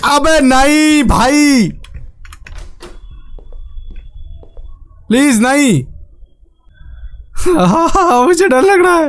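high, drawn-out frightened begging; real fright with a nervous laugh; scared pleading. Real, not acted. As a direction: beg the game to stop, half laughing. Pleading after jump scare.

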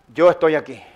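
low, quiet divine reply; God's voice. Retold or imitated. God answering the climber on the branch, God's calm voice. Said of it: "Y Dios le dijo".

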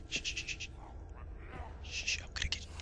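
very soft, unvoiced shushing; hushed, stealthy tease; sneaky whisper. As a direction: whisper it while creeping up on a sleeper. Sneaking up on sleeping enemy.

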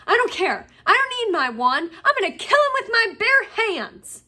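loud, high, furious threat; angry growl. A narrator's reading. Ron threatening to kill Malfoy, in a furious outburst. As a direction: rage while being held back. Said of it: "Ron growled".

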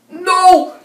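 high, loud cries of "No!"; anguished shouts. Real, not acted. Gamer watching his defence blunder, loud anguished yells. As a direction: howl in disbelief at the mistake. Goal conceded, despair.

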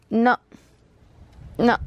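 normal talking voice

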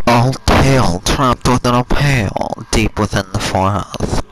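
slow, loud, solemn oracle. Acted. Player voicing the game's oracle, slow and loud. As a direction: boom it out like an ancient voice. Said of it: "oracle voice gives next foe".

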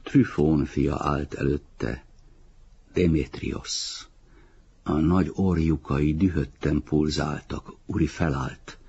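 ordinary delivery

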